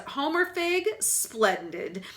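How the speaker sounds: high, beaming delight; delighted grin. A narrator's reading. Frank suddenly delighted by Homer, grinning. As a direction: clap and beam at the boy. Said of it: "suddenly grins and claps".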